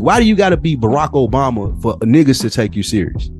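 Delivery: usual speaking voice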